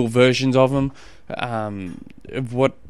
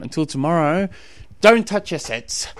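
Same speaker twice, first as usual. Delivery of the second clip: radio sign-off; 4 semitones higher, 6 dB louder than usual. Acted. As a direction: boom it out like a TV host. Catchphrase sign-off.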